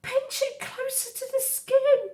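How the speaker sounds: high, loud yelp of a hurt fox; sharp high cry, in pain. Acted. Hurt fox yelps at Roz, high and loud. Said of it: "Fink yelped and said".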